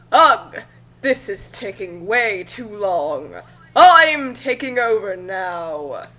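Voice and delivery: loud groan, then slow and commanding; impatient villain seizing control; the Pharaoh Master grows tired and takes over; Pharaoh Master takeover. Acted. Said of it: "said the Egyptian Pharaoh Master".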